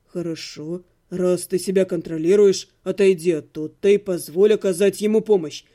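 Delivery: low, steady and level; calm, even voice. A narrator's reading. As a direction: cut through her panic calmly. Necromancer calm, patient.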